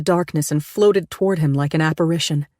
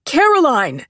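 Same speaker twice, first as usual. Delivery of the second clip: high, loud frantic call of her name; 9 semitones higher, 5 dB louder than usual. Acted. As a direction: call out to her through the door. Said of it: "pounded on the door frantically".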